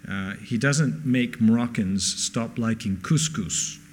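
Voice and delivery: ordinary talk, nothing marked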